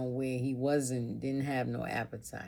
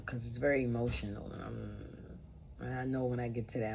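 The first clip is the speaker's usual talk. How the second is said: usual speaking voice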